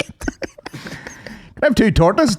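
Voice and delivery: slow, drawn-out mock order of tortas. Friend jokingly ordering tortas, slow and drawn out. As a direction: stretch it out like a goofy customer.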